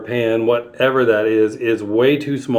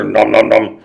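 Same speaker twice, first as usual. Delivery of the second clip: munch like a hungry monster; 2 semitones lower, 4 dB louder than usual. Acted.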